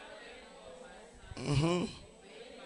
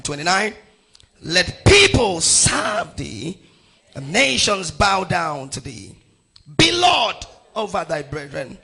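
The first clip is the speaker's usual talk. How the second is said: loud, emphatic scripture proclamation; preacher pronouncing a blessing, phrase by phrase; proclaimed blessing; 9 dB louder than usual. Acted. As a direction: release each phrase with full force.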